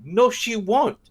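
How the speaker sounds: high, loud defensive Joey; imitating Joey. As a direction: defend her, doing Joey. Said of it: "And Joy's like".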